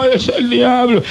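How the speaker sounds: mock the scared evangelical, drawn out; mocking timid believer. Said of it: "como tanto evangélico". (imitación burlona de evangélico asustado, lenta)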